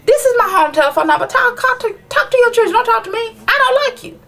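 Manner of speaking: high, fast, exasperated rant; imagined rant at the kids' dad; what I'd tell him. Pretend. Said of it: "that's what I wanna tell him".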